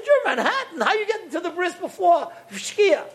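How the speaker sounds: very high, incredulous squawk; astonished outburst. Retold or imitated. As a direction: sheer disbelief, voice soaring up.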